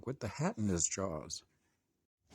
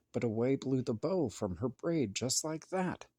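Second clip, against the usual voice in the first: ordinary delivery, nothing marked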